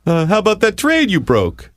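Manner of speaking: high-pitched mocking impression; Dutch. Colleague ribbing him about the broken trade, high imitated voice. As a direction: put on a high, needling questioner's voice. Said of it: "Dutch comes up and says".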